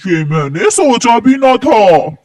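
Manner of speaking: ordinary talk, nothing marked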